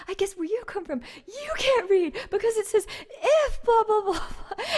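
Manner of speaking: high, loud mocking shout; furious retort. [name] yelling back at the drunk man, furious mockery. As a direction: spit it back at him, seething. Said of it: "I was getting, like, madder and madder".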